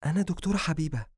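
high-voiced female character; female doctor's voice. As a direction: introduce yourself as a young woman doctor, pitched high.

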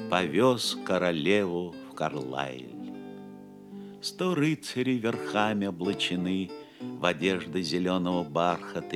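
ordinary talk

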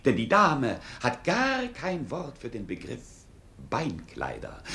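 ordinary talk, nothing marked

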